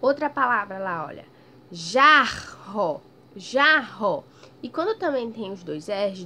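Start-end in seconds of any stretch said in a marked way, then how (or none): 1.7-3.0 "Jarro" loud, open a then strong rr. Shown as a demonstration. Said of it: "o som mais forte do R". 3.4-4.2 "Jarro" loud, open a then strong rr. Shown as a demonstration. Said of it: "o som mais forte do R".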